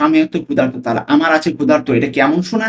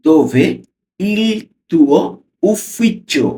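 slow, word by word; teacher modelling pronunciation slowly; slow careful reading; 4 semitones higher, much slower than usual. Shown as a demonstration. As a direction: read "Dove il tuo ufficio?" slowly with pauses. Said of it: "উচ্চারণগুলো একবারে slowly পড়ছি".

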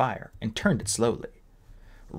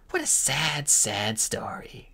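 mock pity; 4 semitones higher, slower than usual. A narrator's reading. Queen mocks the prophecy.

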